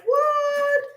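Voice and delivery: shrieked high and loud; a sudden high startled yelp; mock surprise. Real, not acted. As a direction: squeal of fake shock.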